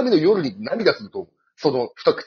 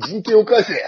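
mock protest shout; 7 semitones higher, 10 dB louder than usual.

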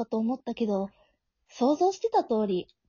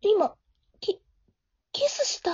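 breathless shocked whisper; stunned whisper; 6 semitones higher, slower than usual. Acted. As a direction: gasp the question out in disbelief. Stunned after a kiss. (キスに驚いて息をのむささやき)

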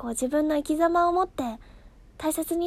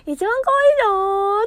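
put-on much higher, louder girly brag; the princess bragging, shrill and loud; voicing Rapunzel; 6 semitones higher, 5 dB louder, faster than usual. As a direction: squeal it like a vain princess. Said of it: "ラプンツェルが".

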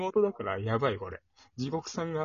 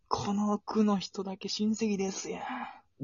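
Kawamura Takashi impression; 6 semitones higher, 4 dB louder than usual. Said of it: "河村隆です".

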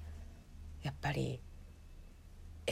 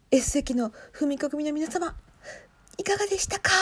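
calling out to fans; 7 semitones higher than usual. Pretend. Viewer asking the S-seat fans how it was, calling out. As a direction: call out high, stretching the final question.

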